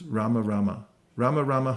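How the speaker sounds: Rama said RA-ma, both syllables clear; Rama, Rama, sounding the second syllable; careful two-syllable Rama. Shown as a demonstration. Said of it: "you should pronounce the syllables".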